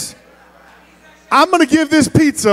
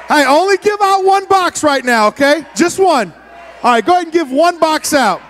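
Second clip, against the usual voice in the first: calling over the crowd; 6 semitones higher than usual. Real, not acted. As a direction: shout instructions to a rowdy room. Excited crowd during pizza giveaway.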